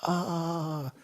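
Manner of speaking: long, high "ah", held; mock scream of pain. King heard by the whole palace, mock scream. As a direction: wail in pain, acted not full-out. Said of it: "could hear him screaming".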